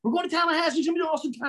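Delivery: high, upbeat party pitch; hyped buddy. Retold or imitated. Scott hyping up Jimmy about the party, high excited voice. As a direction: sell the road trip with glee. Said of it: "he's like".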